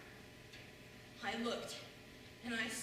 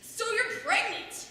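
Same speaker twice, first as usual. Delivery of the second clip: incredulous; 7 semitones higher, 7 dB louder, slower than usual. Retold or imitated. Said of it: "I say".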